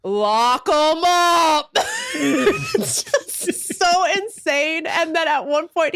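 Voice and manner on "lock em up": very slow, drawn-out Southern order; slow mocking lock 'em up. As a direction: drag it out like a smug sheriff. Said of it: "the guy just goes".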